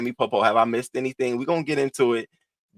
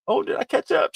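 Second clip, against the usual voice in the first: surprised squeak; faster than usual. Real, not acted. Sudden high exclamation.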